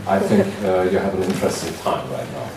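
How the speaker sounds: normal talking voice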